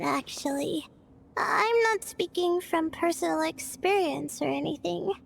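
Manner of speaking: normal talking voice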